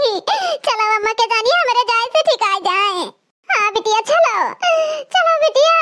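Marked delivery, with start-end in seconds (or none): 4.0-5.7 emotional cry. Acted. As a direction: cry out with feeling. Crying out in emotion.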